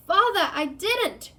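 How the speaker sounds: high, loud, desperate shriek; shrieking plea. Acted. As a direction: shriek it at your father in terror. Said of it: "shrieked the boy in chains below".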